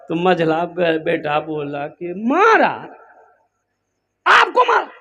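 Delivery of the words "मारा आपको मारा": outraged son. Acted. Son outraged father hit mother.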